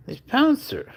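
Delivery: high coo. Real, not acted. Owner coos the cat's name up high. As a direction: sing out the name.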